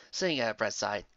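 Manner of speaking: ordinary delivery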